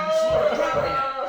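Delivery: high, loud comic outcry; mock horrified protest. Embarrassed nephew refuses aunt's hug, high loud protest. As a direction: wail it like a mortified kid.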